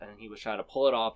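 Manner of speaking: ordinary talk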